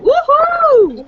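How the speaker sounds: high, loud whoop of welcome; excited cheer. Real, not acted. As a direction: whoop it up for the guest. Host cheering welcome to guest.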